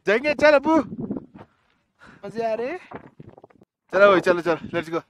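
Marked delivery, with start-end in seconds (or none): none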